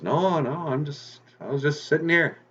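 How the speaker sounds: lightly voiced old man, pitched high. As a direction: play a harmless old man. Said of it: "the old man goes".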